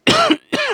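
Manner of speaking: sharp dry cough, pitched high. Real, not acted. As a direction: cough hard into the mic. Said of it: "ich muss husten".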